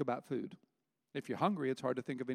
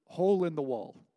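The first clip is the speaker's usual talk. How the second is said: usual speaking voice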